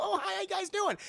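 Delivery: very high, hurried hello; mock awkward greeting. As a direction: imitate someone caught out, greeting strangers. Awkward greeting to startled girls.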